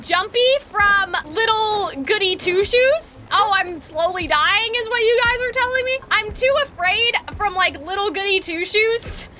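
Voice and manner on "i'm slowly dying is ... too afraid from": normal talking voice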